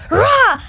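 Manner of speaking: loud, high imitated roar; men's roar. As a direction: mimic men bursting out with a roar. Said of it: "all the men came out and was like".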